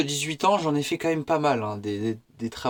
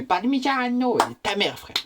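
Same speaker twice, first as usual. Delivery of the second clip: mock Italian chef; 7 semitones higher than usual. Italian chef over the trainee's chopping, mock Italian accent. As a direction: stretch the word in a high Italian impression. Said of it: "tu sais, il est comme ça".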